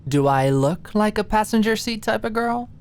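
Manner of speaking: high, bold and cocky. A narrator's reading. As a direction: swagger with bravado. Challenging gaze, bravado.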